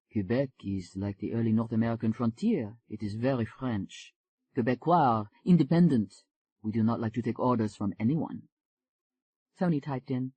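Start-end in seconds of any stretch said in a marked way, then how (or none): 0.1-8.5 a smooth Frenchman greeting her; French accent. Acted. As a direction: lower French accent, gently charming. Jean-Claude describes Quebec.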